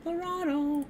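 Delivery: "Laredo" in a very high falsetto squeak; a goofy high-pitched take on the name; squeaky silly voice. Pretend. Playing with the name's sound.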